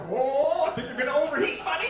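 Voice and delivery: high, loud laughing alarm; alarmed warning. Retold or imitated. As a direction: warn the overheating brain. Brain talking to him.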